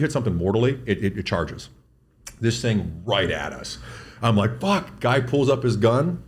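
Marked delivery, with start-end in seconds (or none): 4.6-5.0 startled curse. Retold or imitated. Bull charging at them.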